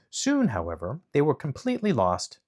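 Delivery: plain reading voice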